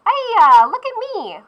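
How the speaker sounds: put-on very high, squeaky little pastry boy; a newborn beignet boy showing off; gleeful beignet boy. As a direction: pop up delighted as a tiny living pastry. Beignet boy comes alive.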